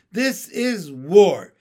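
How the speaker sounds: slow, loud, each word hammered; loud, emphatic turtle shout; shouted declaration. A narrator's reading. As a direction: declare war on the frog. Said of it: "shouted the turtle".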